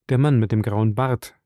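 usual speaking voice

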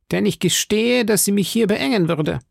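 high-pitched blustering musketeer; Porthos. Acted. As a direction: confess it in a high, put-on voice. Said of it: "sagte Porthos". (hohe, polternde Musketierstimme)

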